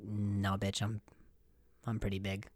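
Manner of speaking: a quiet snooty mountain; mountain talking. Pretend. Mountain dismissing the hikers, low and hushed. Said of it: "the mountain is like".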